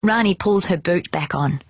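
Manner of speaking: usual speaking voice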